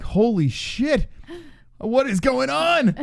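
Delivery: slow, high, stunned disbelief. Retold or imitated. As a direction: let the shock stretch each word. Retells his own stunned reaction.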